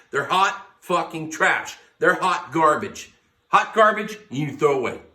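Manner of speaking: loud, high, furious ranting at his own team; angry rant. Real, not acted. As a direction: let it rip like a betrayed fan. Fan's rant about Tennessee.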